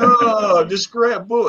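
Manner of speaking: high-pitched mock heckling; mimicked crowd jeer. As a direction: jeer in a high voice, imitating fans. Retelling crowd jeering him.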